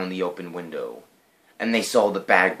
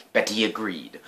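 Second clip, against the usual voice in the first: usual speaking voice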